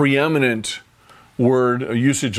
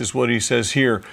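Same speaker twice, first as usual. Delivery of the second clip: ordinary talk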